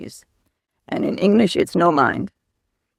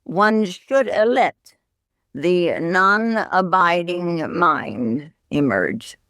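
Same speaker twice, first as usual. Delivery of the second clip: very slow, measured scripture reading; solemn sutra quote, drawn out; slow recitation; much slower than usual. Shown as a demonstration. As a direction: read each word out slowly like sacred text. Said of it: "it is written".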